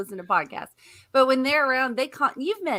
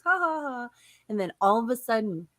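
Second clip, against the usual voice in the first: normal talking voice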